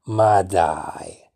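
ordinary delivery

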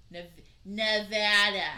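Nevada with the middle a as in cat, drawn out; Nevada the local way. Shown as a demonstration. Hosts from Nevada teach the right way to say the state name, slow and clear. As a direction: say Nevada with a flat short a, slowly. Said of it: "Say it like a Midwesterner".

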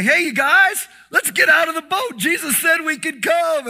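high, loud shout to the boat; a bold call to jump out now; excited call. Retold or imitated. Peter calling the other disciples out of the boat, shouting. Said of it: "I can just hear Peter say".

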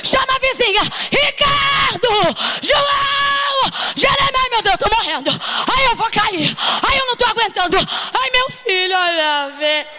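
high, frantic wailing and calling out names; hysterical panic. Pretend. Mother panicking and calling the neighbours, high frantic cries. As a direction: act out hysteria, shouting for help.

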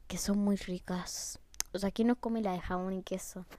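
soft whispered chat. Real, not acted. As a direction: whisper like sharing a secret. Whispered chatty aside.

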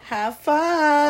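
high, mock-cheery farewell; teasing send-off. Clerk to the customer leaving with condoms, high teasing send-off. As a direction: sing out a teasing goodbye.

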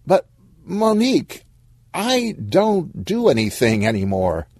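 high-pitched plaintive whine; whining. Acted. Stan complains to Monique about his lost job, whining. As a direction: protest in a high, needy whine. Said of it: "Oh, stop whining".

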